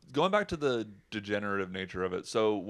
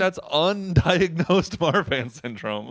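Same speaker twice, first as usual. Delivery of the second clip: louder, higher and slower with a laugh; sarcastic mock realization; 9 semitones higher, 8 dB louder, slower than usual. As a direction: drag it out with exaggerated irony. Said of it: "And I'm like".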